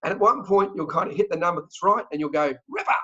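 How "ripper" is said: punchy, pleased Australian cheer; delighted Aussie exclamation. Acted. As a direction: celebrate getting the right price. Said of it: "That's fantastic".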